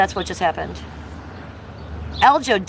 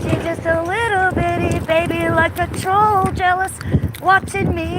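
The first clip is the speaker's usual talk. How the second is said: high silly voice; 9 semitones higher than usual. Acted. Silly put-on baby voice.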